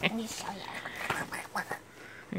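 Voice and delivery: slow, soft whisper. Real, not acted. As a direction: lean in and whisper it slowly. Aside while puzzling over name.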